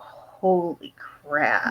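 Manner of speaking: low, very slow and hushed; mock-stunned, dropping right down; stunned disbelief. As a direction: draw it out softly, as if stunned. Stunned reaction being retold.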